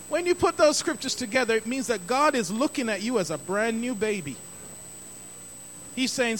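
ordinary talk, nothing marked

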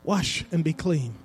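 soft, low hush; a low gentle whisper of a command; still small voice. Retold or imitated. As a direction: murmur it gently like God's quiet voice. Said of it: "Still small voice".

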